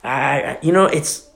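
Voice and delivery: usual speaking voice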